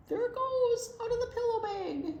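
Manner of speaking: high delighted exclaim; excited cry. Acted. Animals crying out as the moon escapes, high and thrilled. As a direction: let the moon fly with joy.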